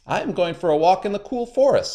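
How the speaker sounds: sly spider, high and sweet; a crafty trickster sounding friendly; high, cunning spider; high Anansi voice. A narrator's reading.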